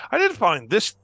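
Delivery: lightly voiced, punched-up reveal; Leonard. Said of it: "and you say to everybody".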